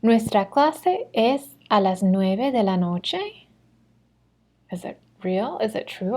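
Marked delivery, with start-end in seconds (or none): 0.0-3.5 slow practice question, climbing at the end of "noche"; rising question intonation. Shown as a demonstration. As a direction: read it slowly as a yes-or-no question, lifting "noche". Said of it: "let's practice reading these".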